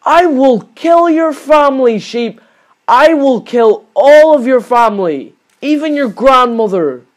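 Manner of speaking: loud, high, slow threat; mock-serious rage, loud and slow; furious threat. Real, not acted. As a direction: swear revenge on the sheep, slowly and loudly. Threatening the sheep after losing.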